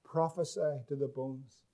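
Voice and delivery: low, hushed voice of the Lord; hushed, weighty "Prophesy to the bones"; hushed emphasis. Shown as a demonstration. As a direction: drop low and soft for God's command. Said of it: "Don't miss this".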